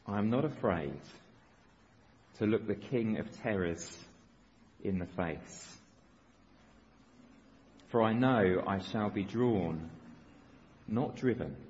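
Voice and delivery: put-on reading an old prayer slowly and solemnly. Shown as a demonstration. Preacher to congregation, reading an old prayer slowly. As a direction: let each line of the prayer land, slow. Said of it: "let me read it slowly".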